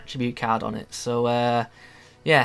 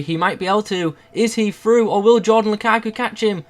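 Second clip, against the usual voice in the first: high, louder, urgent; excited commentary; 7 semitones higher, 4 dB louder than usual. Real, not acted. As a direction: call the breakaway excitedly. Commentating a breakaway.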